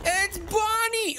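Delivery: high, startled panic; scared. Real, not acted. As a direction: yelp in fright. Sudden monster appears.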